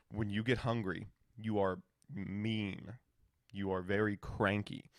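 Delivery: low, soft, measured mock seriousness; lightly imitating a stern wife; imitated grave verdict. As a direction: deliver a careful verdict, pausing between phrases. Wife gravely tells him he's cranky.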